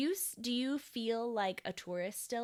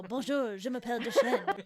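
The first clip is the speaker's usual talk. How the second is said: a showy French "Bonjour, je m'appelle Deschênes", louder and laughing; bold French greeting; 3 semitones higher, 7 dB louder than usual. Pretend. Imagined entrance announcing his French name to locals, louder mock French. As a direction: burst in announcing yourself in French. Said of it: "kick doors open and go".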